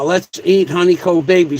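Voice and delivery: ordinary talk, nothing marked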